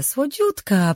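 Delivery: „słodziutka” dużo wyżej, przeciągle i opadająco; zaśpiewaj „słodziutka” wysoko i fałszywie czule; przesłodzone przymilanie. Acted. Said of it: "Głos miała promienny i fałszywie przymilny". (swatka mizdrzy się do Rin: „słodziutka” wysoko, przesłodzone)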